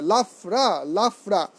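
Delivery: "লাফরা" said twice, the f crisp; model pronunciation. Shown as a demonstration. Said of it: "এটা প্রচার করতে হবে". (স্পষ্ট করে "লাফরা" দুবার)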